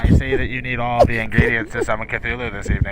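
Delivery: breathy, muffled intercom voice; drive-thru speaker voice. Pretend. Drive-thru worker joking about a Cthulhu order, muffled and breathy. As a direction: whisper it through a bad speaker. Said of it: "The radio voice".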